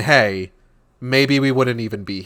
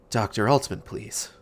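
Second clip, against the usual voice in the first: hushed caller; 4 semitones lower, 7 dB quieter than usual. A narrator's reading. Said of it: "barely louder than a whisper".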